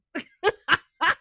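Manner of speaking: a burst of real loud laughter; loud real laugh breaking out. Real, not acted. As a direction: crack up out loud at your own story. Teller cracks up at own story.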